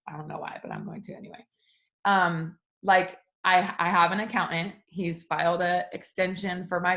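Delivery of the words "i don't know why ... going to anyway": much softer, fading to a whisper; sheepish hush. Real, not acted. Admitting to viewers she put off her taxes, whispered. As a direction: let it slip out under your breath.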